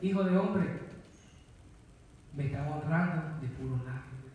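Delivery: low, soft secret aside; hushed voice of God confiding to Ezequiel; secretive hush. Retold or imitated. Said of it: "dice así secreto".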